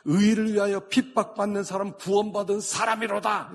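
high, loud pulpit declamation; forceful proclamation. Real, not acted. Preacher declares the poor in spirit saved, forceful proclamation. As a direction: ring out the verse and its promise.

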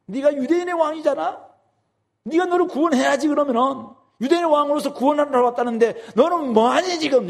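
high, scornful taunt; mocking taunt. Soldiers taunting Jesus to save himself, high mocking. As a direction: jeer at him from below the cross. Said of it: "이렇게 지금 희롱하고 있는 것이죠".

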